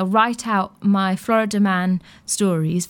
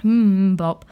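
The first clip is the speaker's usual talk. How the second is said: Mbop popped out loud as one beat; punchy Mbop; 4 dB louder than usual. Shown as a demonstration. Radio host introducing the song, punchy Mbop. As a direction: hit Mbop louder, falling. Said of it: "essentially Mbop".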